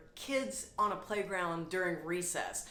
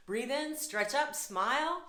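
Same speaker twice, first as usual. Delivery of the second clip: rising stretch cue; 5 semitones higher than usual. Shown as a demonstration. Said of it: "invite you all to breathe in and smile".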